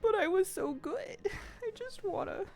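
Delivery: high, pleading dog voice; the dog whining that he was so good; voicing the dog. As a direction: plead like a puppy who wants a friend. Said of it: "And he was just like".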